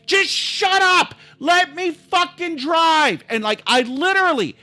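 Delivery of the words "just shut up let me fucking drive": high, drawn-out angry retold yell. Driver cuts off mother's protest, angry retold yelling. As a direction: lose your temper, slow and high. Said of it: "now I've been yelling".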